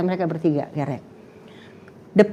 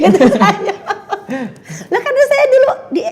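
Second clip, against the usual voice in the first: high laughing through words; 12 semitones higher, 6 dB louder than usual. Real, not acted. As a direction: talk while laughing hard. Laughing while telling. (bicara sambil tertawa keras)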